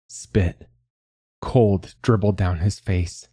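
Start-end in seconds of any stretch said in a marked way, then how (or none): none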